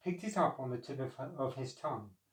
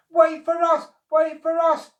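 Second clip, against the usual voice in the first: very high, loud desperate screech; 14 semitones higher, 13 dB louder than usual. A narrator's reading. Monkeys screeching to the departing ark, very high and loud. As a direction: screech it in panic. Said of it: "screeched the monkeys".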